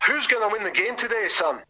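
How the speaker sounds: high Scottish captain's voice; broad Scottish accent. Retold or imitated. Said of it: "quite a broad Scottish accent".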